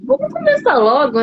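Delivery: mock-impatient, pitched well up; lightly acted eagerness to get going. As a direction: voice your own impatience in a high, playful quote. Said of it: "eu não gosto de aguardar muito".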